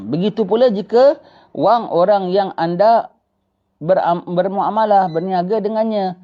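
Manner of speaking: slow, measured reading aloud; teacher reading the kitab passage to the class; text reading. Acted. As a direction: read it out as quoted text, unhurried. Reads from the kitab.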